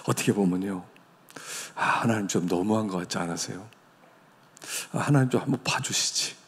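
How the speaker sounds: usual speaking voice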